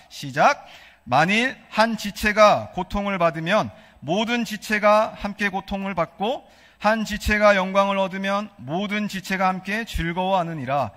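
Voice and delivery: normal talking voice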